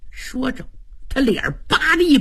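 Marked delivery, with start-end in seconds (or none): none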